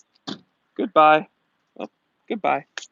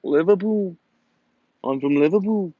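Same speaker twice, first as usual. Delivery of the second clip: mock Scouse lilt; imitating a Liverpudlian; Liverpool accent. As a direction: put on a playful Liverpool accent. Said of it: "impression of a Liverpool accent".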